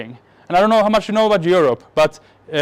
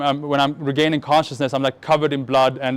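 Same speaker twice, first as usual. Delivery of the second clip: normal talking voice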